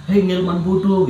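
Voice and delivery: high, slow taunting call; mocking voices. Voices in his head taunting the patient, slow high jeer. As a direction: taunt him like the voices did.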